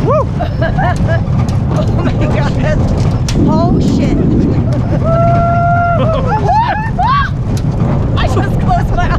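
screaming with laughter on the ride; sledding downhill. Real, not acted. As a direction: shriek and laugh through the rush.

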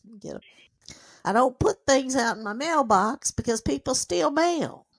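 ordinary talk

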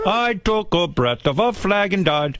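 high, slow mock voice. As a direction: squeak out the dead man's words slowly. Mocking the dead man.

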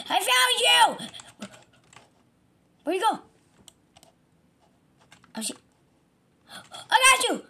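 high, loud panicked cartoon voice; frantic game character. Victim chases the thief.

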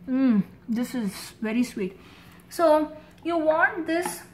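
talking between bites; chewing while talking. Real, not acted. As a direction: mouth partly full. Seen 0: Bites gourd at -2s, jaw works and talks through mouthful.

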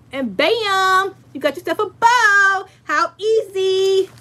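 high, loud, drawn-out showy reveal; playful infomercial-style excitement; hammed-up triumph. Shown as a demonstration. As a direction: stretch it out like a big ta-da. Triumphant reveal of finished bow.

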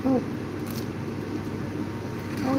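usual speaking voice

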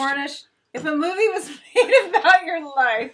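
words broken by a laugh; laughing question. Real, not acted. Host laughing while asking the guest her question. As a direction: giggle through the question.

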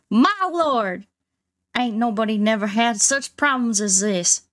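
ordinary talk, nothing marked

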